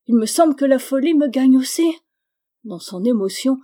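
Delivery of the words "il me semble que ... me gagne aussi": high and loud, near panic; loud despair. A narrator's reading. Charlotte forgets to keep her voice down, loud despair. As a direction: let the voice run away from her. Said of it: "oublié de modérer sa voix".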